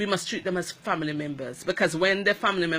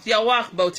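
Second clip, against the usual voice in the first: scolding; 2 semitones higher, 6 dB louder than usual. Retold or imitated. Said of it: "I hear her tell the gentleman".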